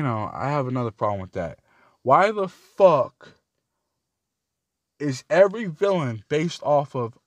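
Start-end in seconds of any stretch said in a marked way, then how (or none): 2.0-7.2 loud, slow, drawn-out angry why the fuck; exasperated outburst. Real, not acted. Fan ranting about Marvel villains, loud slow outburst. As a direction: let it burst out, stretching each word.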